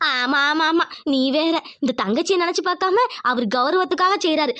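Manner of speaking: normal talking voice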